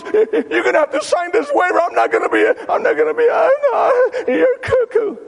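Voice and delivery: very high, loud, hammed-up frantic sputter; panicked protest. Psychiatrist demanding a waiver from her patient, frantic high shouting. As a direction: sputter in exaggerated alarm.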